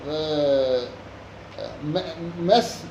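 ordinary delivery

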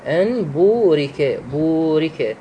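بورك with the "uu" held long for two beats; elongated vowel. Shown as a demonstration. Teacher showing students the madd, the "uu" of بورك drawn out. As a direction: stretch the waw in بورك slowly. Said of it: "مقدار المد بورك بمقدار حركتين".

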